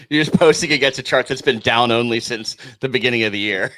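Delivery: laughing tease. Real, not acted. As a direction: tease him while cracking up.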